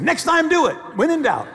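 high, loud acted scolding; hammed-up, high-pitched reprimand; exasperated yell. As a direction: shout the order in comic exasperation. Exasperated order to the ushers.

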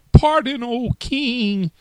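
high, loud squeaky plea; a tiny mouse crying out for mercy; squeaky pleading mouse voice. Acted. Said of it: "cried the little mouse".

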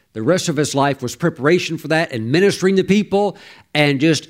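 usual speaking voice

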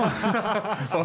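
a sudden high, loud burst of laughter. Real, not acted. Host bursting into laughter at the guest's joke, loud laugh.

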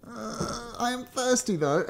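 hesitant brother. Retold or imitated. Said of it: "he's like".

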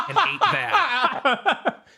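slow, high, laughing delivery; laughing punchline. Real, not acted.

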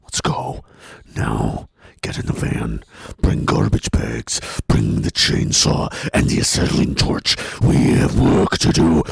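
very low, slow menacing rasp; disturbingly intense. Retold or imitated. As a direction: rasp orders like a scary henchman. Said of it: "you're scaring everyone".

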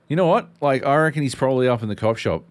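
quick and keyed up; excited retold quote. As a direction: blurt out the plan.

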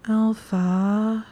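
high, drawn-out needy whimper; long whimper. A narrator's reading. Hermione longing for her alpha, whimpering. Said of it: "came out in a long, low whimper".

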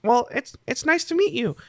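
high-pitched, polite goblin voice; young goblin. Acted. Goblin meets the party, high and polite. Game master plays the goblin.

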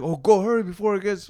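high, loud hurry-up; friend rushing him. Retold or imitated. Friend rushing him to hit the dab, high and urgent. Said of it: "And he's like".

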